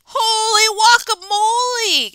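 high, loud startled cry; shocked exclamation. Acted. Kitten startled by the mirror, high loud cry. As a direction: yelp it in shock. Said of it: "exclaimed Skippy John Jones".

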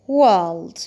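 "world" with a rounded vowel, R dropped; a low, loud British "world". Shown as a demonstration. Teacher repeating British "world" for learners, British pronunciation. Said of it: "في البريتش أكسنت هنقولها".

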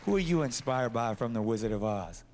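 ordinary talk, nothing marked

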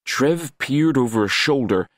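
usual speaking voice

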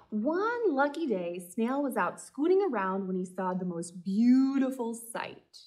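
put-on lively read-aloud voice for kids; story narration. A narrator's reading. Librarian reading a picture book to children, lively.

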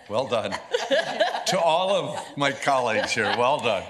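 high, loud, laughing through words; can barely talk for laughing. Real, not acted. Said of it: "I'm gonna take a moment to recover".

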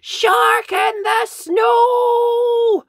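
very high, loud, drawn-out yell. A narrator's reading. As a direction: yell it across the park, slowly. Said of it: "he shouts".

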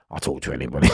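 ordinary talk, nothing marked

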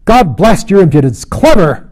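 loud, high, outraged outburst; startling, discordant angry shout; sudden shrill cry. A narrator's reading. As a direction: explode in shrill indignation. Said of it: "cried out the other in a sudden shrill voice".

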